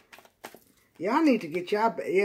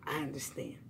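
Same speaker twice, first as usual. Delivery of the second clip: soft sympathy; 6 semitones lower, 12 dB quieter than usual. Real, not acted.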